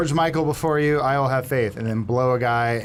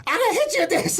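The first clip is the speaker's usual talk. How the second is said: put-on much higher cocky kid voice; bratty David. David cheekily warns the giant. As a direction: tease him like a cheeky kid.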